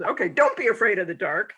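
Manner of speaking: hammed-up comfort for a scared kid; talking to a small child. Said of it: "Like, to a five-year-old".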